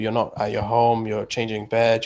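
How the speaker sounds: normal talking voice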